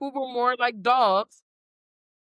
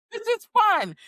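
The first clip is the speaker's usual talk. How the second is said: much higher, louder, gleeful; childlike glee; 12 semitones higher, 4 dB louder than usual. Retold or imitated. Describing her kid side, gleeful. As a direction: say it like a twelve-year-old. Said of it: "I'm like".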